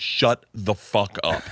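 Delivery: slow, deliberate "Shut the fuck up."; dad ending the conversation slowly; slow shutdown. Retold or imitated. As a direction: draw it out flatly. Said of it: "I was like".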